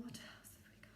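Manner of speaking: soft whispered question; hushed aside. Real, not acted. Whispered to class.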